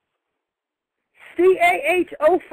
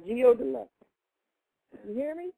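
phone held away; 2 semitones lower, 7 dB quieter than usual. Real, not acted. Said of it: "you moved the phone away from your mouth".

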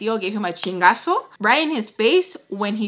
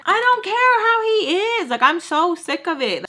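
high, raised-voice outburst; loud and fed up; 9 semitones higher, 4 dB louder than usual. Retold or imitated. Arguing about her brothers' dad, loud exasperation. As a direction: snap loudly, out of patience. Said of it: "I'm getting kind of, like, loud".

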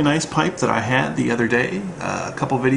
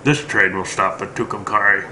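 deep, low cowboy delivery, lower than his own; low Van Cleef growl; 5 semitones lower than usual. Retold or imitated. Fan quoting The Good, the Bad and the Ugly in a low villain voice. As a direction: lightly growl it like the film villain.

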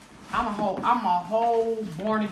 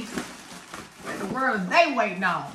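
normal talking voice

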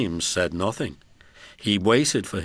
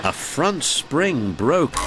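usual speaking voice

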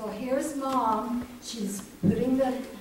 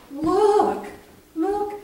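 higher and much louder, calling out; excited discovery; 9 semitones higher, 10 dB louder than usual. Acted. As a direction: point at the basket in delight. Princess finds the baby.

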